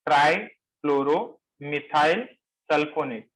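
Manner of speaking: ट्राईफ्लोरोमिथाइल slow in short chunks, each lifting, then sulfonate falling; measured repetition. Shown as a demonstration. Teacher repeating the compound name for the class, measured chunks. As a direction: repeat the long name slowly but steadily.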